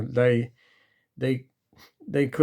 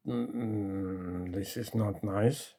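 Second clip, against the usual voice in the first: uneasy thought; 5 semitones lower, 10 dB quieter, much slower than usual. Retold or imitated.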